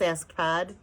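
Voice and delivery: plain reading voice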